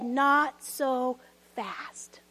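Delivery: whispered, slow and low; hushed god voice. Acted. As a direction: let it drop to a breathy whisper. God interrupts, whispered aside.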